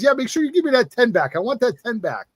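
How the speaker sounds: put-on high-pitched New York kid laying down terms; Jersey New York accent. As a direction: insist like a Jersey wheeler-dealer. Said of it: "New York accent".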